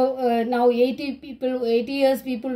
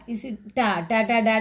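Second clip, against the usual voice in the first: normal talking voice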